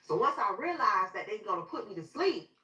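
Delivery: ordinary delivery, nothing marked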